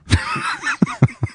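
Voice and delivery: high-pitched burst of laughter; real giggling laugh. Real, not acted. Host cracking up after teasing the caller, high laugh.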